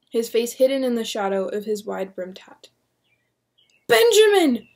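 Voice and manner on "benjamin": high loud scream. Acted. William confronting the magistrate, loud scream. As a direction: scream his name. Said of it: "William screamed".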